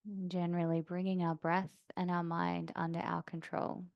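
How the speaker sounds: calm yoga-teacher meditation voice, long pauses; soft, settled breathing-practice guidance; guided meditation. Real, not acted. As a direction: guide them gently into stillness, unhurried. Leads seated breathing meditation.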